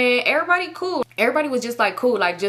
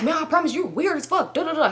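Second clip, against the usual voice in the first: mock fed-up boyfriend; 7 semitones higher than usual.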